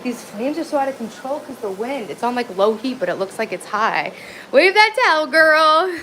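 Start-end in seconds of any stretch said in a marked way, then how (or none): 4.5-6.0 excited cheer. Real, not acted. Cheering herself on over flames.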